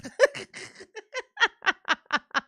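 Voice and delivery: big high cackling laugh. Real, not acted. Streamer cracks up at her own joke, high cackle.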